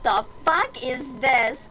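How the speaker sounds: incredulous. Retold or imitated. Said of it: "and I'm just like".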